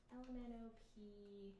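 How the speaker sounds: usual speaking voice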